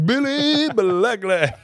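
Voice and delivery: very high, loud drawn-out holler; sing-song mocking yell; excited teasing shout. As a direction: belt it out, stretching each word. Repeats shouted chant, retold.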